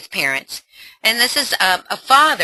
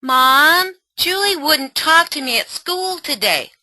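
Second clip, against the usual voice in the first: put-on higher teenage daughter complaining; 9 semitones higher, 4 dB louder than usual. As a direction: play the upset teen girl, higher. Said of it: "role play mother and daughter".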